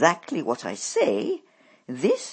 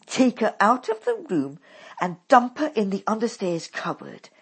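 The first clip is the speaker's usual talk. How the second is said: harsh commanding mother; 4 semitones higher, 9 dB louder than usual. A narrator's reading.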